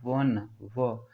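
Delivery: वन then व, very slow and drawn out; sounding out wa. Shown as a demonstration. Teacher sounding out one's व for students. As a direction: stretch व slowly as a w sound. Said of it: "उसका उच्चारण है क्या?".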